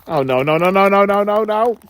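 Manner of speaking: panicked. Real, not acted. Getting beaten in game.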